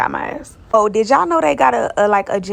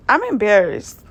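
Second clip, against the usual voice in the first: ordinary talk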